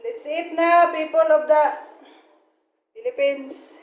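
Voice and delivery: calling out. Real, not acted. Mother summons the viewers to eat, calling out. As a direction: announce it loudly to everyone.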